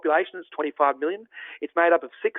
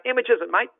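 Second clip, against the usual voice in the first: "How much is it, mate?" said higher in an Australian accent; Aussie shop question; 7 semitones higher than usual. Shown as a demonstration. Visitor asking an opal dealer the price, Australian accent. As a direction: ask the price like a true-blue Aussie. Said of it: "you say".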